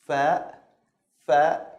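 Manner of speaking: easy English F, then "a". Shown as a demonstration. Teacher showing an easy sound, English-like F. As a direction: clear F in "fa". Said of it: "like the English F".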